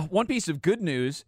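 usual speaking voice

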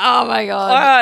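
loud, drawn-out mock horror; retold mortified shock. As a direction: re-enact your horrified reaction, stretched out. Retelling own mortified reaction.

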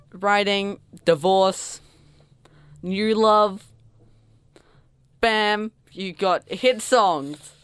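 punchy mock recipe. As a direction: present each step like a magic formula. Mock recipe for writing hits.

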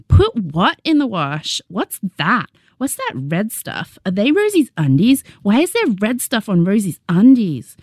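lightly voiced nosy little sister; curious little kid. A narrator's reading. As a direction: pester like a curious kindergartner.

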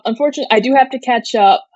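ordinary talk, nothing marked

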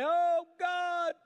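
high, loud wailing cry to a false god. Acted. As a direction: wail it to the sky like a frantic prophet. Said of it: "They began to cry. They began to say".

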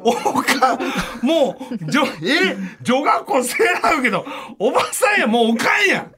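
high, laughing half-shout; after laughing hard. Real, not acted. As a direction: still cracking up while talking. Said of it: "ほんま笑い転げてもうて".

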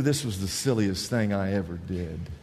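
low, subdued muttered complaint; low-pitched private skepticism; grumbling soldier. Acted. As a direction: mutter it to yourself, resigned. Soldier's private thought while marching.

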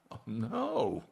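high, breathy-free gasp of refusal; mock horrified no. Preacher mocking congregation's refusal, mock dismay. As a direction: play the listener recoiling in mock horror. Mock reluctant listener reply.

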